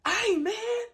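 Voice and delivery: very loud, high-pitched shout; yelling coach. Retold or imitated. Coach hailing a kid whatever the reason, yelling. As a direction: holler to grab the kid's attention. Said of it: "if I'm yelling at them".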